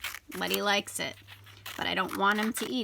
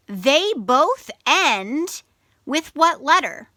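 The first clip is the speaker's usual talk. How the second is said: quizzing; 5 semitones higher, 6 dB louder, slower than usual. Shown as a demonstration. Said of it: "teacher quizzing children".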